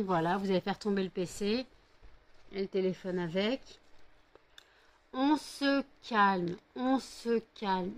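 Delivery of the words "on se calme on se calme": very slow, drawn-out calm-down. Real, not acted. Owner settling her rowdy dogs, very slow and drawn out. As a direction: stretch each word to settle them.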